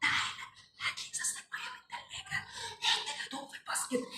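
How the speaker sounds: everyday requests in a faint whisper. Shown as a demonstration. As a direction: whisper household orders with almost no sound. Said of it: "اتكلمي بالصوت ده". (همس من غير صوت)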